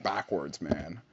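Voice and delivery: normal talking voice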